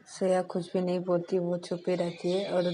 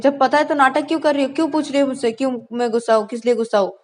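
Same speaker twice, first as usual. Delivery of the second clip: loud, high, fast yelling; angry shouting; 6 semitones higher, 10 dB louder, faster than usual. Acted. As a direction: shout her down in anger. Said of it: "इतना चिल्ला क्यों रही हो?". (ऊँची आवाज़ में चिल्लाना)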